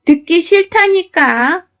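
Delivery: high, drawn-out protest; narrated yell. A narrator's reading. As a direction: voice a fed-up mother-in-law crying out. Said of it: "자꾸만 소리를 지르며".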